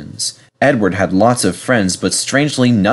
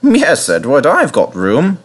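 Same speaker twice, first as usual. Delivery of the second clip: laughed; 5 semitones higher, slower than usual. A narrator's reading. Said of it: "laughed the vicar".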